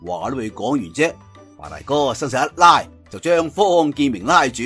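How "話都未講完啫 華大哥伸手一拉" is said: ordinary delivery, nothing marked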